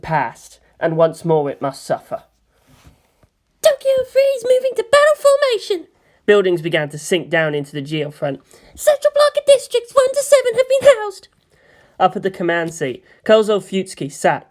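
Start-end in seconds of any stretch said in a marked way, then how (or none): 3.6-5.9 much higher, flat PA announcement; high announcer. Acted. Base announcer reports the city going to battle formation, high and flat. 8.7-11.3 much higher, flat PA announcement; high announcer. Acted. Base announcer reports the city going to battle formation, high and flat.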